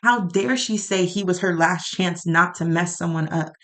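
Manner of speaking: usual speaking voice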